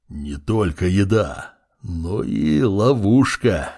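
slow, low, otherworldly; slow undead lord voice. A narrator's reading. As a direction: drag the words out ominously. Undead lord's slow speech.

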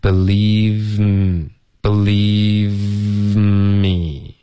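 "believe me" stretched slowly, V melting into a long M; slow-motion believe me on one steady airflow; continuous linking. Shown as a demonstration. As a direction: hold the V and slide into me without a break. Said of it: "进入到嗯".

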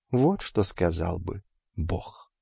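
plain reading voice

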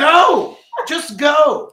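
high, loud, impatient command; exasperated shout. Real, not acted. Host cuts off the player's question, high exasperated shout. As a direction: shout over their question to get them moving.

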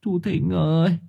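high, weepy plea; tearful call. A narrator's reading. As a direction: call to him through welling tears. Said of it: "Thưởng Báo lại trào nước mắt".

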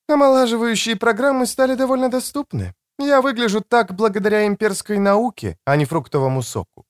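high-pitched voice for a woman; woman's voice. A narrator's reading. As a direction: pitch it up for the female character. Narrator voices the woman character.

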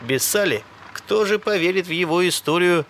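plain reading voice

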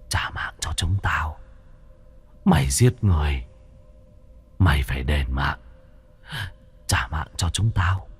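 low, hushed ghost lament; wailing vengeful ghosts. A narrator's reading. Wronged souls demanding their lives back from the killer, low ghostly wail. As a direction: moan it like the restless dead. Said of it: "chúng nó kêu than".

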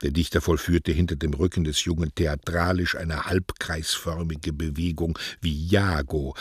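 ordinary delivery

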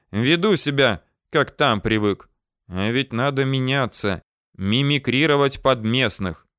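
usual speaking voice

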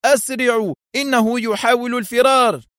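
high-pitched urgent call; urgent shout. A narrator's reading. Adham hurries the arriving guards, shouting. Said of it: "وصاح".

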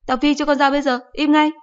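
high, loud threatening shout; angry mother. Acted. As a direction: mom losing her temper.

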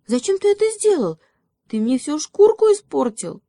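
very high, loud, indignant squeak; piercingly high mousy protest; squeaking little mouse. A narrator's reading. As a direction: squeal the complaint like a tiny mouse. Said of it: "запищал мышонок".

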